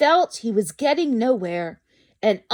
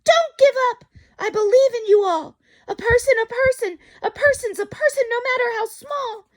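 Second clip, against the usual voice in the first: calling out; 12 semitones higher than usual. Acted. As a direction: shout encouragement across the distance. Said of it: "he managed to call to the mayor".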